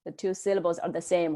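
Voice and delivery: ordinary talk, nothing marked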